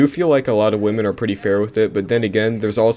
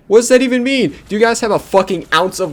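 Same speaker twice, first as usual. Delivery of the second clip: much higher, louder outraged shout; outraged yell; 11 semitones higher, 4 dB louder than usual. Real, not acted. Vlogger yelling at women in interview clips, outraged shout. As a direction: blow up at the absurdity.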